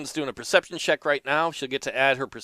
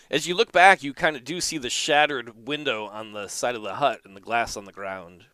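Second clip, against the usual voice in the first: usual speaking voice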